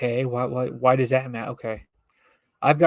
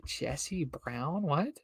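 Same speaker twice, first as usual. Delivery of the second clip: puzzled aside; 10 dB quieter, much slower than usual. Real, not acted. Reader's puzzled whispered aside.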